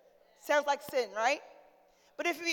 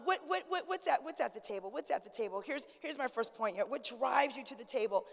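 ordinary delivery

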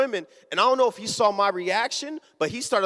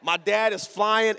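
ordinary delivery

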